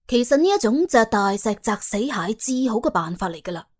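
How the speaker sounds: ordinary talk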